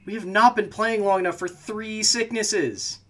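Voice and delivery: high, loud, drawn-out protest; exasperated outburst. Real, not acted. Player protesting a third sickness in the game, exasperated. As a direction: plead loudly with the game to stop.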